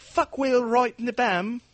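high, loud British jeer; put-on British outburst; mock British accent. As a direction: hurl it out in a hammed-up British voice. Joins mock British abuse.

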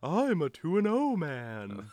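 slow, high, drawn-out ghostly voice. Pretend. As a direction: moan it like a ghost. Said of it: "I sound like the ghost of Daniel Day-Lewis's character".

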